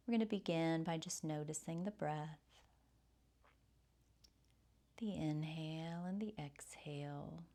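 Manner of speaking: soft, soothing breath-led meditation voice; guided meditation. Real, not acted. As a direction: softly guide them through the breath, with long pauses.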